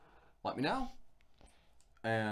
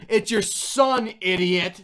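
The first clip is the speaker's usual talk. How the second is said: exasperated mom; 8 semitones higher, 11 dB louder, much slower than usual. Retold or imitated. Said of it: "And she goes".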